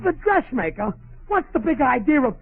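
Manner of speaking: high-pitched, outraged disbelief; indignant surprise. Acted. Husband learns roast went to dressmaker.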